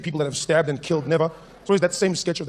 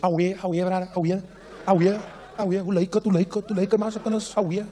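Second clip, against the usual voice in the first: put-on Cape Town accent, slightly raised pitch; suave Cape Town guy; 3 semitones higher than usual. Cape Town guy greets strangers like old friends, suave Cape accent. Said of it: "they've got their suave".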